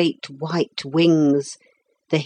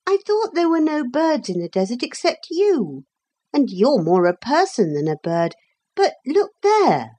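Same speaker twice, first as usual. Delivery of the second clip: put-on high boy's voice, rising to a call; questioning boy; 8 semitones higher than usual. A narrator's reading. Philip to the parrot over cocoa, high and puzzled, then exclaiming. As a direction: voice a puzzled boy who suddenly spots something. Philip talks, spots something.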